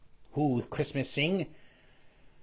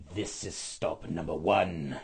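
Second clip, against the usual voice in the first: hissing Grinch; 7 semitones lower than usual. A narrator's reading. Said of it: "the Grinchy old claws hissed".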